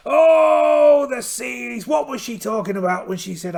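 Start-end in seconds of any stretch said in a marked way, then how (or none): 0.1-1.9 full-throated shout, much higher on the Oh; shouted cry. Acted. Pirate captain shouting at the stormy seas, loud theatrical cry. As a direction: bellow Oh, the seas! over the storm.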